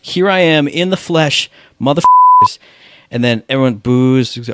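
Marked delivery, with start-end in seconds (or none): 0.0-2.6 higher, building to a huge yelled motherfuckers; mock villain entrance. Costumed presenter crashing the awards show, hammed-up roar. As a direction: bust in bellowing like a heel on stage. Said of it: "He goes".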